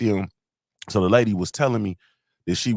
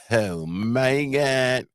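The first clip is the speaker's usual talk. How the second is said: very slow, drawn-out "oh my God"; starstruck awe; 3 semitones higher, much slower than usual. Retold or imitated. Fan retelling a call with Dawn Staley, starstruck. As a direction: stretch each word out in mock awe. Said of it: "Like, I was starstruck".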